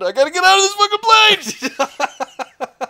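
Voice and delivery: high, slow, loud frantic yell; imitating a frantic passenger, high and loud; desperate shout. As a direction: bellow it like a man desperate to escape. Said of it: "He just decided".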